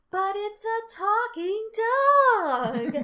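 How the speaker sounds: drawn-out, high-pitched excited whine; excited plea. Acted. Making a point to each other with an inside joke, excited plea. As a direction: stretch it out high, insisting it's amazing. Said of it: "go to each other and be like".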